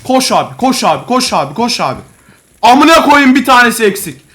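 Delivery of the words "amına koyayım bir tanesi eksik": louder, higher frustrated shouting; angry yelling. Real, not acted. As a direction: yell at your teammate in frustration.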